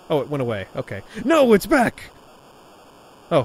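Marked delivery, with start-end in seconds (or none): 1.2-2.1 alarmed yell at the tentacle; panicked shout. Acted. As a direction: cry out in sudden fright.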